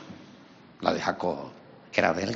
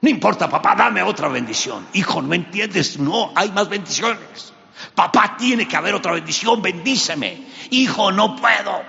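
much higher, louder desperate begging; heated pleading; 11 semitones higher, 6 dB louder than usual. Acted. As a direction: beg with everything. Esaú and Isaac plead loudly.

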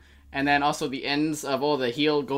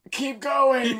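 high mock voice; 8 semitones higher, 4 dB louder than usual. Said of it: "he's just like".

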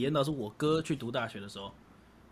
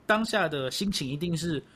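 usual speaking voice

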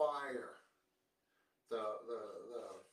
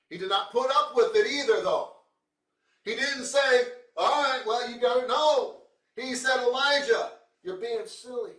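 full-force preaching; 10 semitones higher, 11 dB louder than usual. Acted.

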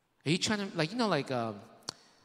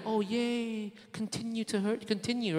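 higher, sarcastic yay; mock cheerful; 7 semitones higher than usual. Preacher voicing a trapped bear sarcastically, higher mock glee. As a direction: fake glee at being hurt.

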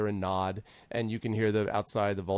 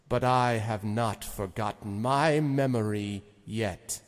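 Zephyros; 5 semitones higher, 4 dB louder, slower than usual. Acted. Lord ordering Envon out of his castle, slow and commanding. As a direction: dismiss them with slow, weighty authority. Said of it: "you've never heard Zephyros talk like that".

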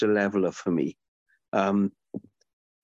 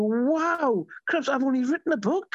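surprised; 12 semitones higher than usual. Retold or imitated. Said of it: "And I'm thinking".

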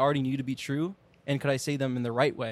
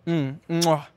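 french chef; 2 semitones higher, 4 dB louder than usual. Retold or imitated. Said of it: "you're like".

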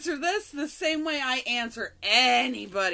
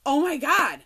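sudden realization; 4 semitones higher, 9 dB louder than usual. Retold or imitated. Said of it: "And I'm like".

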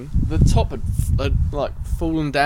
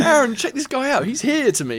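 excited call; 9 semitones higher than usual. Retold or imitated. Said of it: "I'm going".

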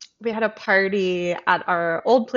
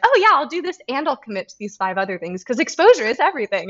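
eager rushed yes-saying; 3 semitones higher, faster than usual. Retold or imitated. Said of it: "just being like".

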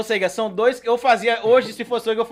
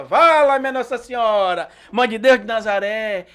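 comic lament. Acted. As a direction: wail like a folksy buffoon. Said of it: "o cara tropeçava na peça e começava".

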